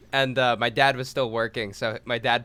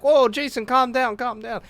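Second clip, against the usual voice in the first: higher, urgent placating; alarmed pleading; 11 semitones higher, 3 dB louder than usual. Retold or imitated. Coworkers begging the furious man to calm down. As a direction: try to settle an angry giant, fast.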